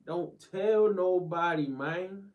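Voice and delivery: high, loud gleeful shout of it. Real, not acted. Streamer shouting his catchphrase at the chat, loud and high. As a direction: yell it out to the chat.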